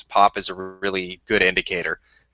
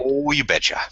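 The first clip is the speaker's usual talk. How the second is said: put-on Minnesota accent; 5 semitones higher than usual. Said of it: "the Minnesota accent".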